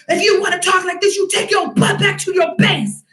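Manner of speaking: mock furious command; retold angry telling-off. Telling the soldier to go back to base, angrily. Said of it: "I was so mad".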